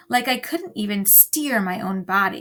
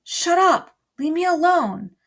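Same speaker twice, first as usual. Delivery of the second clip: higher, louder frantic yell; scream; 8 semitones higher, 5 dB louder than usual. A narrator's reading. Bryce fed up with the girls' chatter, screaming. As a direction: scream it out, desperate. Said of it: "I wanted to scream".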